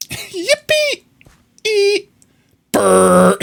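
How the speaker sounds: very high squeaky meme squeal; yippee squeal. Pretend.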